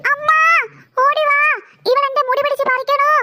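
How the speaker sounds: crying out for help; wailing for mother. Acted. Child calls mother over hair-pulling, wailing. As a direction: wail out drawn, sobbing calls. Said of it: "എന്തിനാടി ഇവിടെ കിടന്ന് മോങ്ങണത്".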